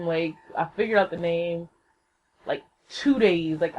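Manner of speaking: usual speaking voice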